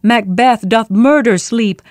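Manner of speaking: slow loud echoing cry; imitated crying voice. Macbeth recalls the voice that cried out, slow and loud. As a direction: call it out slowly like a haunting voice. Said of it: "I heard a voice cry".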